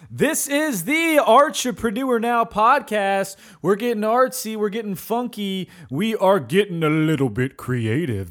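showman announcer hype; ringmaster. Acted. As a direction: sell the show like a ringmaster. Hyped announcer intro.

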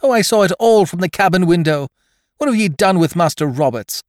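high, loud, outraged lass; impassioned female character's high cry. A narrator's reading. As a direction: accuse them at the top of your voice. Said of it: "she cried out".

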